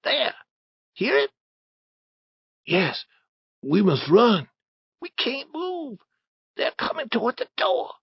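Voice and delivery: very high, hushed frightened voices; urgent squeaky alarm, afraid to be heard; panicked boys. Acted. Hidden boys panic as men approach.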